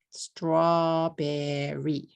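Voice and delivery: "Strawberry" slowly, in three beats straw-be-rry; three-syllable English. Shown as a demonstration. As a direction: stretch "Strawberry" with str kept as one cluster. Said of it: "Three syllables".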